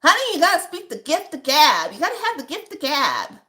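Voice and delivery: high, loud grandma voice giving advice; grandmother. Retold or imitated. Grandmother lecturing her grandchild on the gift of gab, loud and high. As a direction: boom it out like a sassy grandmother. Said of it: "how my grandmother would say".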